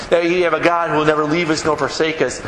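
ordinary talk, nothing marked

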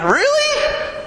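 you can't be serious; laughing surprised question; incredulous laugh. Retold or imitated. Pastor doubts the guy's excitement.

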